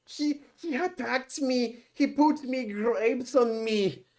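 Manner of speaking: very high, slow mock accusation; old woman. Old woman accusing him of attacking her, high mock wail. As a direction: imitate an old woman complaining, drawn out. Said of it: "the old woman was like".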